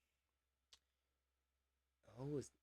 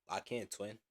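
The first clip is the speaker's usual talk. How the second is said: put-on Atlanta accent; 9 dB quieter than usual. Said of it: "you can hear the difference in my voice".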